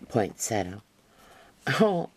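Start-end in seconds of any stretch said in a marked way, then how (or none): 1.8-2.1 loud, high burst of recognition; a sudden bright oh; higher, louder oh of sudden memory; surprised oh. Real, not acted. Suddenly remembering the word.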